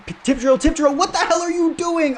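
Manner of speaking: high, loud exasperated yell at the screen; real frustrated yelling. Real, not acted. Gamer yelling at his defender over a dropped tip drill, furious. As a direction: blow up at your player for missing the catch. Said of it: "Sorry for getting that mad about it".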